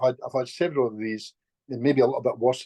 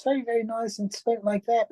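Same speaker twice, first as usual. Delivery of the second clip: higher, light and sweet; showing the client's usual sweet voice, higher; sweet light lady's voice; 10 semitones higher than usual. Shown as a demonstration. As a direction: lift into a lovely light lady's voice. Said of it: "lovely light voice like this".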